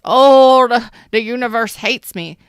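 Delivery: loud, high mock whine of victimhood; exaggerated self-pity. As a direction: wail it with heavy emphasis, like a martyr. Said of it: "I'm putting emphasis on this".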